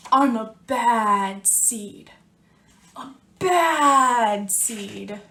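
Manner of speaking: slow, heavy bad seed voice; gruff bad seed. Teacher reading to kids as the bad seed, slow and heavy. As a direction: drag it out like a grumpy seed.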